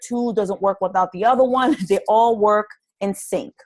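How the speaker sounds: normal talking voice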